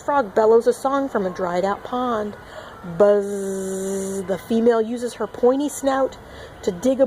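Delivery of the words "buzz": ordinary talk, nothing marked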